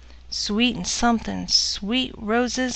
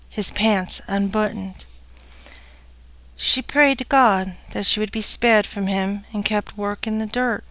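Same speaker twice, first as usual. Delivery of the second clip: plain reading voice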